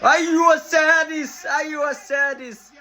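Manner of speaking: stretched victory cry; goal celebration shout. Real, not acted. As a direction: yell it out slowly as the goal goes in.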